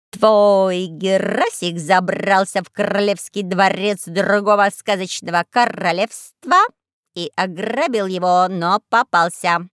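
raspy cawing crow. Acted.

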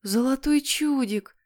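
high, slow wonderment; awed delight. Acted. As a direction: marvel at a rare treasure. Boy finds the rare prize. (восторженно, высоко и протяжно)

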